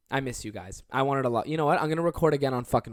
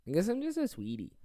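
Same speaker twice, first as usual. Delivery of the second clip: higher, sing-song "sweetie"; cute self-praise, higher; cutesy sweetie; 8 semitones higher than usual. Real, not acted. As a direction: coo it like a sweetheart. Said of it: "repeats it cutesy".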